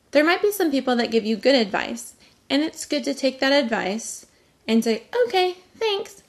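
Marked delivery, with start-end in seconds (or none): 5.2-6.2 light, high "okay, thanks"; breezy polite brush-off. Pretend. Brushing off unwanted advice, breezy and polite. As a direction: sweetly wave off the advice.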